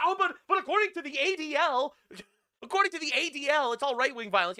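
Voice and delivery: mocking leftist voice. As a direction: whine it like a smug debater, laughing. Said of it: "and be like".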